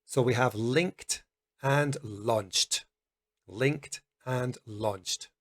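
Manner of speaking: slow, careful linked and launched; slow teaching demo. Shown as a demonstration. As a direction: sound out linked and launched slowly. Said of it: "It does not use your vocal cords".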